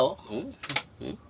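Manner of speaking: ordinary delivery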